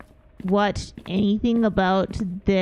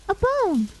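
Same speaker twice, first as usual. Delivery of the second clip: surprised gasp; 13 semitones higher than usual. Real, not acted. Streamer finding a bone in the game, gasping. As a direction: gasp then a much higher surprised cry.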